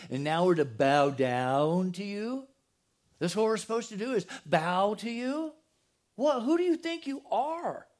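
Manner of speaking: incredulous brothers, rising to a high challenge; acted indignant disbelief. Brothers answering Joseph's dreams of bowing down, indignant disbelief. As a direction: stretch it out, pitch climbing in mock outrage.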